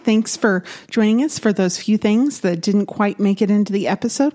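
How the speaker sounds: upbeat host outro read; podcast outro. Acted. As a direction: sign off like a radio host.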